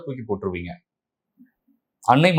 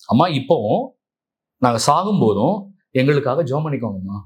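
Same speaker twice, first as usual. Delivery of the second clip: ordinary talk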